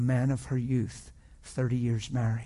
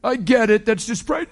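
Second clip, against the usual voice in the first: high, louder dismissive shout; preacher scolding her with a loud brush-off; 9 semitones higher, 7 dB louder, slower than usual. Retold or imitated. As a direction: wave it away loudly. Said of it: "And I'm like".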